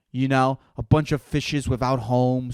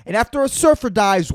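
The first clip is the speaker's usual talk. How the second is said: usual speaking voice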